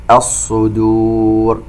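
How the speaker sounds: "الصدور" slowly recited, ṣād emphatic and full, dāl kept light; heavy ṣād. Shown as a demonstration. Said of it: "ننتبه إلى تفخيم الصاد". (تلاوة "الصدور" بتفخيم الصاد وترقيق الدال)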